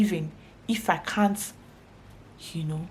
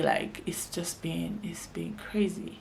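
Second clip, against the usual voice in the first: drops to a whisper, slowing on the first words; whispered aside; 8 dB quieter, slower than usual. Real, not acted. Telling viewers how bad the clients have been, in a whisper. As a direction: let it slip out under your breath.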